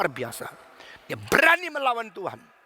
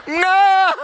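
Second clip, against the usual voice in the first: loud, high mock-child "no"; imitated toddler's yelped refusal; child's scared refusal; 12 semitones higher, 10 dB louder than usual. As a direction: blurt it out like a frightened kid. Child refuses to try again.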